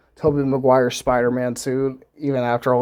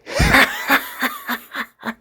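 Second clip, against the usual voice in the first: high, wild villain cackle imitated; maniacal Goblin cackle. A viewer recalls the villain's laugh with an imitated maniacal cackle. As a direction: cackle like the Green Goblin in the movie. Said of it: "he's just, like, laughing maniacally".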